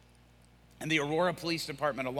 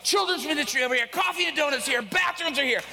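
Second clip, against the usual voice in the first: frantic announcing; 12 semitones higher, 6 dB louder than usual. Retold or imitated. Frantic announcements darting around.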